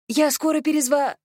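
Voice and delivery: high-pitched hurried blurt, cut off; hurried call-out. A narrator's reading. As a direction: call it out high, without really shouting. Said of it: "торопливо выкрикнула Алина". (торопливый высокий выкрик, оборванный на полуслове)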